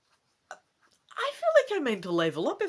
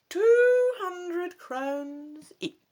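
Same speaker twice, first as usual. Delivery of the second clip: put-on slow, much higher and louder old woman; old woman's answer; 12 semitones higher, 7 dB louder, much slower than usual. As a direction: draw out the price slowly. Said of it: "Madame Ursula answers".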